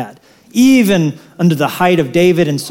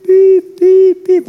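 very high, louder truck-like beeps; high beeping; 14 semitones higher, 4 dB louder, much slower than usual. Retold or imitated. Bread truck arriving, high mock beeping. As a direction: beep like a delivery truck.

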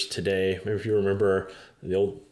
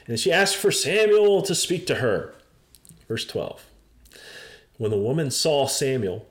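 ordinary talk, nothing marked

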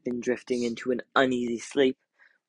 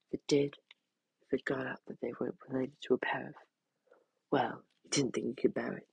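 whisper; 5 dB quieter, faster than usual. A narrator's reading. Dread narration.

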